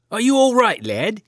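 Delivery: a hint of a concerned farmer uncle checking on the lad; gentle uncle asking if the boy is hurt; kindly uncle. A narrator's reading. Uncle voiced in story.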